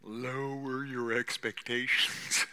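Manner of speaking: slow, stretched-out counsel; measured, unhurried admonition; drawn-out advice. Real, not acted. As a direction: draw the words out slowly, held back. Same advice given quietly, then forcefully.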